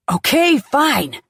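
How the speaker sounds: loud, slow shout. Acted. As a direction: shout in surrender. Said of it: "Dad shouts".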